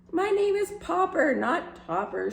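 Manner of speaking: indignant caller correcting the clerk; shouted correction. A narrator's reading. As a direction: shout the name right back. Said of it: "shouted Mister Popper".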